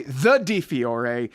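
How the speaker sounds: "the" drawn out slowly before Di Fiore; grand, slow emphasis on "the"; stress on THE. Real, not acted. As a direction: lean long and hard on "the". Emphatic introduction of guest's name.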